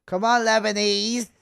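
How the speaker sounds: high, loud urging shout; loud call. Real, not acted. Calling out during play.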